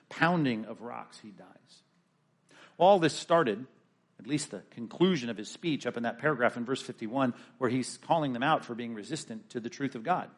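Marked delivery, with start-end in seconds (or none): none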